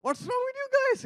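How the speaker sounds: shocked high outburst; high incredulous cry; outraged what. Retold or imitated. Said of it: "We're like".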